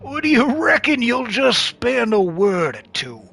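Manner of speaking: high, slow, hammed-up drawl; stretched-out, high-pitched yarn-spinner voice; Sam Rockwell. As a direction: play a drawling, folksy traveler. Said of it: "going for Sam Rockwell".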